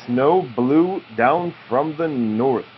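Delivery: ordinary delivery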